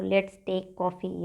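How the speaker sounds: very slow and even; slow drill. Shown as a demonstration. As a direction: lay out each word for the class. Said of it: "कह सकते हो".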